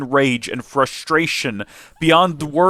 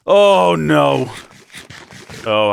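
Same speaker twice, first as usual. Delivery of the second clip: ordinary delivery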